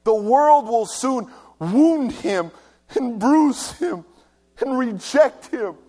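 loud, high preaching at full force; fervent preaching. Acted. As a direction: drive it home to the congregation.

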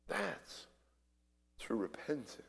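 usual speaking voice

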